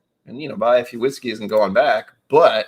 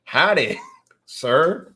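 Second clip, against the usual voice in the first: mock Texan greeting; 6 semitones higher, 5 dB louder, much slower than usual. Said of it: "I was like".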